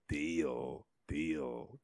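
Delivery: "Deal" repeated low and soft, ee vowel drawn out; vowel demo. Shown as a demonstration. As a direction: quietly model "Deal" with a long ee. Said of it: "E A here is pronounced like".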